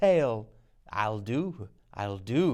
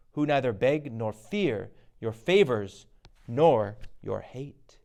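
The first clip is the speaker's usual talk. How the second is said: ordinary talk, nothing marked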